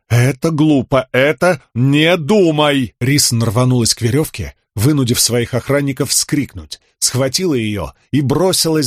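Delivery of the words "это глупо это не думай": твёрже и громче повторить; сказать громче, решительно себя одёргивая; громче, с нажимом. Acted. Рисн уговаривает себя. (нажим на «глупо», громче)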